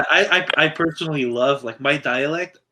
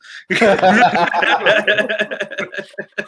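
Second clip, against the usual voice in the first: big laugh; 7 semitones higher, 3 dB louder than usual. Real, not acted. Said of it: "and then my dad got shit".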